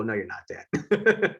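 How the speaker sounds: put-on slow, drawn-out voice of teasing kids; slow playful kid voice; kids teasing dad. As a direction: drag it out like kids putting dad in his place. Kids deflating their dad.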